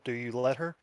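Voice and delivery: ordinary talk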